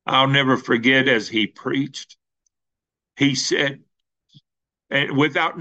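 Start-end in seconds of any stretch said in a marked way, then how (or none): none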